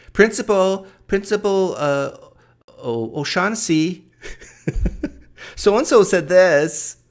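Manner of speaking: put-on a kid running to the principal; junior high tattletale. Mocking convention staff as a kid tattling to the principal, whiny and loud. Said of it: "Tattletales in junior high school".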